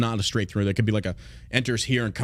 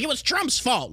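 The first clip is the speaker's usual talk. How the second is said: high mock voice; mock commenter; 13 semitones higher than usual. Streamer imagines commenters blaming Trump, high mock voice. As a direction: mimic a silly excuse.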